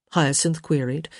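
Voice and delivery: plain reading voice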